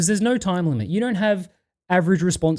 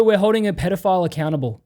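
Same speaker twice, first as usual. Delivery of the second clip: ordinary talk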